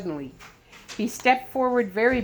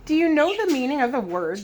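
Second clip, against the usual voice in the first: sarcastic; 7 semitones higher, 4 dB louder than usual. A narrator's reading. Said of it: "queried sarcastically".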